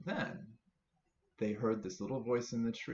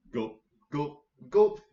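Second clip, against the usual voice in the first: big swallowing gulps, last one much louder; gulping sounds; 4 semitones higher, 8 dB louder, slower than usual. Acted. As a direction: gulp it down loudly. Monster drinks the stew.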